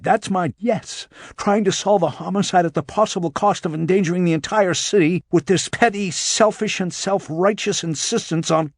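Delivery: high-pitched, cutting him off angrily; angry. A narrator's reading. Angry agent accuses detective of endangering the city, indignant. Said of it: "how angry she was".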